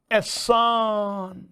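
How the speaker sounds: एहसान drawn out slowly as a chant, syllables stretched; mnemonic pronunciation. Shown as a demonstration. Teacher drilling students on the mnemonic, slow drawn-out एहसान. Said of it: "देखो, मैं एहसान नहीं बोल रहा हूँ".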